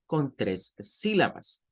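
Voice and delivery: ordinary talk, nothing marked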